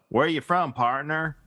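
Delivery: mock Southern drawl, pitched a little high; badly faked cowboy twang from the South; bad Southern accent. Shown as a demonstration. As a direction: do a hammy, unconvincing Southern accent. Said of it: "listen to me do the South".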